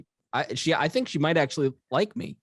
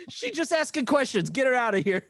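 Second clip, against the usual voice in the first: imitated Miss Pat; 9 semitones higher than usual. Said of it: "You were like".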